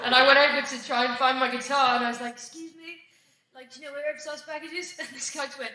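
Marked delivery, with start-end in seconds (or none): 2.3-4.9 very soft, higher-pitched polite enquiry; timid polite question. Retold or imitated. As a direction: ask small and hushed, barely disturbing anyone. Retelling a timid question to airport staff.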